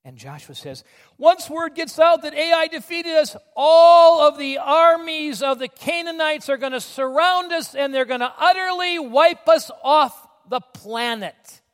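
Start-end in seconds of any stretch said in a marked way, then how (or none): 1.2-11.6 loud, high, slow proclamation of doom; hammed-up panic, stretching the big words; alarmed outcry. Acts Joshua dreading total defeat.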